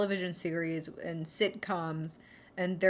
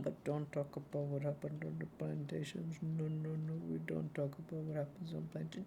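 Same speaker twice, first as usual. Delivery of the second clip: hushed aside; 3 semitones lower, 12 dB quieter than usual. Retold or imitated. Hushed aside inside belle impression.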